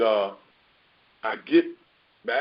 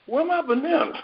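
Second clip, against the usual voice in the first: alarmed; 12 semitones higher than usual. Retold or imitated. Retold panic at missing bananas.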